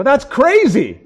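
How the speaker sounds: loud, high-pitched acted disbelief; exclaimed protest. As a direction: burst out in mock protest. Acted protest in couple's argument.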